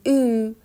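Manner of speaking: "او" said as a long German ü; German u-umlaut. Shown as a demonstration. Teacher models u-umlaut for learners, "او" as long ü. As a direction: say "او" as ü, an ee with rounded lips. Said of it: "صدای او کشیده ای".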